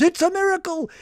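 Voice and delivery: high mock cry of wonder; imitating a shout. Wife at the funeral crying out a miracle, high. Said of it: "picks up her beads and shouts".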